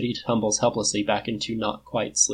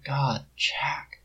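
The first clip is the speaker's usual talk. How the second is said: breathy tearful whisper; 3 semitones higher, 5 dB quieter than usual. A narrator's reading. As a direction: barely voice it, overcome. Overwhelmed after confession.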